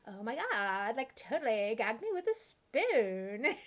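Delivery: lightly voiced Valley Girl accent, slow; a hint of an 80s Valley Girl drawl. Mocking the teens she heard in Southern California, Valley Girl accent. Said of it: "a Valley Girl accent".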